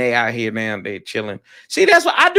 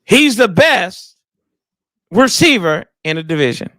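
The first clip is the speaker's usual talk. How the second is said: said with chest; 4 dB louder than usual. Pretend. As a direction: push it out from the chest, pausing after BEST. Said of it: "Say it with your chest".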